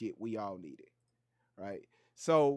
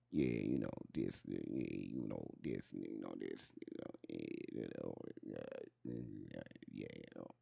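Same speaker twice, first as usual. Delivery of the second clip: much lower, mumbled rapper voice; 21 dB quieter than usual. Retold or imitated. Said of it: "conversation with [name]".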